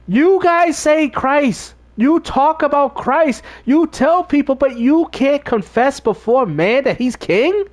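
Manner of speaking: high, slow, incredulous reproach; indignant challenge. Retold or imitated. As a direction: confront them in disbelief, drawing out each phrase. Acts out his indignant challenge.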